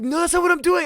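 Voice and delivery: very high, loud panicked squeal; hammed-up shriek of protest; frantic retold denial. Patient denying it to the nurse, frantic high squeal.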